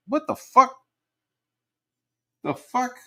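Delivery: high, squeaky outraged disbelief; sudden high-pitched what the fuck; disbelieving squeal. Real, not acted. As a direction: shriek it in shocked disbelief.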